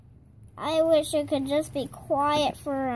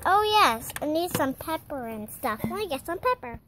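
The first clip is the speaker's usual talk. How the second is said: ordinary talk, nothing marked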